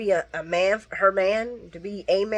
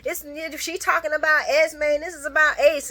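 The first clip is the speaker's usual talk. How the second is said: mock angry retort; 6 semitones higher, 4 dB louder than usual. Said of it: "And he says".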